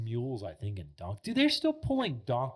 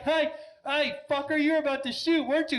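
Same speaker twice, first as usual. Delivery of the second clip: high, loud mock-angry outburst; raised, high-pitched retold anger; retold pissed-off; 14 semitones higher, 5 dB louder than usual. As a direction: imitate an indignant hunter telling someone off. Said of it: "but then he's pissed".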